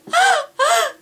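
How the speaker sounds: high, loud fake-sobbing gasp; laughing gasps like crying. Shown as a demonstration. Prankster on the phone fakes crying, gasping. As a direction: gasp as if breathless from laughing, passing it off as sobs. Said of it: "I'm making that kinda sound like crying".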